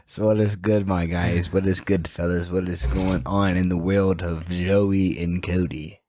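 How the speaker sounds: low, booming radio greeting; deep host voice. Acted.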